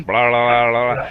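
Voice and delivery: choppy fan-warbled voice. Retold or imitated. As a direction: yell blah into a fan. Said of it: "You know when you yell into a fan?".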